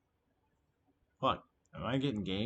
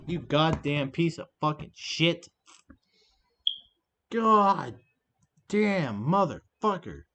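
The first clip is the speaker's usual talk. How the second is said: real rage, loud and higher; furious shouting; 5 semitones higher, 7 dB louder, slower than usual. Real, not acted. Streamer cursing out the opponent over a card, furious yelling. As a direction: scream insults at the opponent, drawn out on the slow curses.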